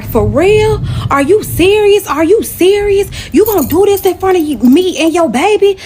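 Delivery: high-pitched, outraged fussing at him; indignant, high-voiced disbelief, retold; retold screaming. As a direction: act out screaming at him without full volume. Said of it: "I was screaming".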